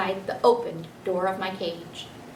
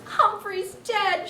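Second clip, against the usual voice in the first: sobbing; 13 semitones higher, much slower than usual. Acted. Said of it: "she sobbed".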